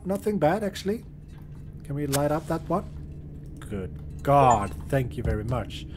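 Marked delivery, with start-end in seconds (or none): none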